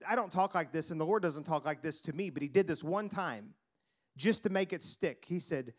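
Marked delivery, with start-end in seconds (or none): none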